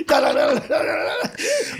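high mock running sound; sprinting noises. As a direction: imitate a comic sprint in quick syllables. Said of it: "then he starts sprinting".

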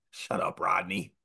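hushed mock jab; whispered heckle. Players in the handshake line to the coach, whispered mock heckle. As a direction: whisper it like a pretend heckler behind his back.

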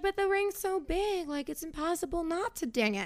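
high, soft, helpless mock protest; mock girlfriend voice. Host imitates the fiancée protesting about her big ring, high and soft. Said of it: "And she's like".

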